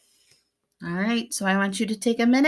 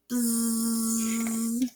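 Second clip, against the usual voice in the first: bee buzz; 3 semitones higher, 6 dB quieter than usual. Acted. Bee in story.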